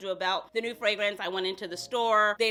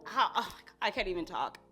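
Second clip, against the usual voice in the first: ordinary talk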